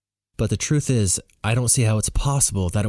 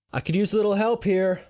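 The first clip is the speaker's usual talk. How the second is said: shout; 12 semitones higher than usual. Acted. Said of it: "I shout to the small crowd".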